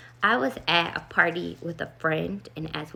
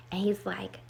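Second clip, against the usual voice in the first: ordinary delivery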